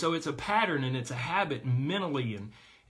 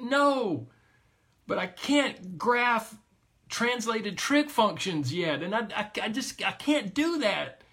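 put-on upset child; 6 semitones higher, slower than usual. Child near tears explains.

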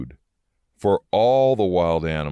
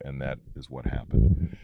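usual speaking voice

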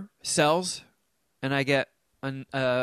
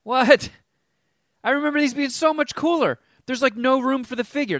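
indignant surprise; 10 semitones higher, 5 dB louder than usual. Real, not acted. Disappointed in the toys.

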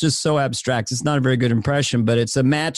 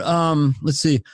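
ordinary delivery

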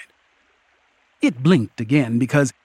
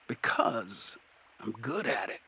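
put-on low-key, very soft old man; 4 semitones lower, 12 dB quieter than usual. A narrator's reading. Old man behind the troll's neck, very soft. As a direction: murmur it softly by the ear.

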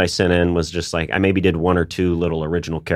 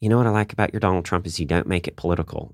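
put-on quick, softer Southern accent; 4 dB quieter, faster than usual. Comedian quoting a fan from the Southeast, Southern accent. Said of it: "I'm doing a Southern accent".

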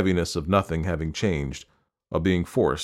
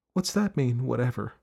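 questioning mother; 6 semitones higher than usual. A narrator's reading. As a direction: echo the child's word back gently, querying it. Mother questions daughter's shrug.

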